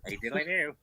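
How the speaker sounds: lightly voiced cheerful neighbour, nasal; a hint of Flanders' chirpy greeting; Ned Flanders impression.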